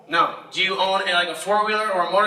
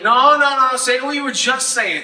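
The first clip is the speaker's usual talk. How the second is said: louder, higher, pressing no no; mock insistent; 5 semitones higher, 4 dB louder than usual. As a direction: cut her off hard.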